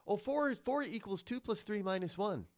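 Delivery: high-pitched, eager mock answer; mock pupil answer. Host mocks new math lessons, high-pitched pupil's answer. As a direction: lift the voice high for a silly pupil.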